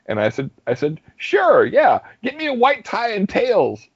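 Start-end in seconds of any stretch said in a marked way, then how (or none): 1.2-3.9 hammy showbiz. Retold or imitated. Agreeing to host, hammy.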